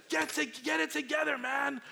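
high, sharp shout; mock scolding. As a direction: bark at a panicking passenger. Flight attendant scolds panicked flyer.

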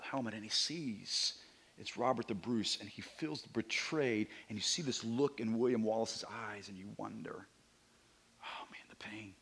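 hushed. Real, not acted. Describing William Wallace's betrayal.

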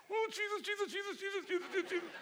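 high, soft, rapid pleading; mock terror in turbulence, begging under the breath; whimpering "Jesus" on a bumpy flight; panicked prayer. Shown as a demonstration. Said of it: "and I do this".

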